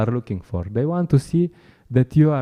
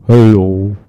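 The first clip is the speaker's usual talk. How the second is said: loud, flat sales pitch by rote; bored distributor; 3 semitones lower, 10 dB louder than usual. Retold or imitated. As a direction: recite the pitch like a tired book seller. Mocks a bored book distributor.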